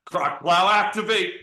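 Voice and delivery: hammed-up robotic call; robot activation voice. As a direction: announce it like a gadget powering up. Robotic product command.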